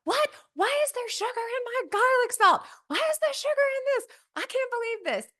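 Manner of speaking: high, loud, outraged yelling; shocked screaming. Retold or imitated. Finding sugar in her garlic salt, screaming in shock. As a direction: shriek in disbelief at the pantry. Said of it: "screaming and yelling".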